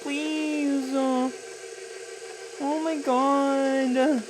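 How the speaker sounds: high, drawn-out mock wail; mock pleading. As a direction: beg in a very high voice, stretching the words. Mock begging over breaking pasta.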